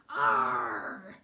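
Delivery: a loud pained roar from a bitten tooth. Acted. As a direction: roar out as if you bit on iron. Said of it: "he yelled".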